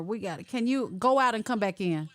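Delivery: normal talking voice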